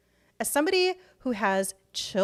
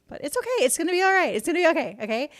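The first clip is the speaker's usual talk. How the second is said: self-reassurance; 6 semitones higher, 5 dB louder, much faster than usual. Real, not acted. Reassures herself after layoff news.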